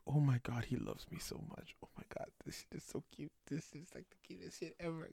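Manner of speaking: breathless giddy whisper; gushing whisper. Retold or imitated. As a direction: whisper it, swooning. Girlfriend gushing over song.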